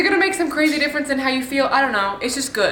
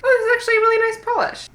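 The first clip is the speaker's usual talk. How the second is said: surprised delight; 12 semitones higher, faster than usual. Real, not acted. Surprised by a good polish.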